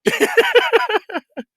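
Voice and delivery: high, loud cackle of laughter; sudden shrieking laugh; burst of laughter. Real, not acted. Reacting to a joke about the rating.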